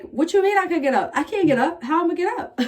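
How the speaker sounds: high, incredulous whine; disbelieving protest. Retold or imitated. Patient protests to the nurse that she can't get up, high and incredulous. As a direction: balk at being told to stand. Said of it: "And I'm like".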